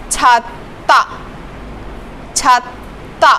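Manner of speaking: छाता with a final t sound, said softly; pronunciation demo. Shown as a demonstration. Teacher modelling the t sound, soft. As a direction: say छाता ending with a t. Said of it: "इसकी आवाज़ आएगी त की".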